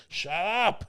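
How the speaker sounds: put-on sudden high snapped yell at the baby; mock yell at baby. [name] yells at the baby mid-beat, high mock yell. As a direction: bark it at the kid, pitched high. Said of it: "And he's yelling at the baby".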